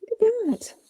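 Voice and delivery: a high puppet voice showing itself off. Pretend. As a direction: speak as the puppet, high and delighted. Puppet's voice begins.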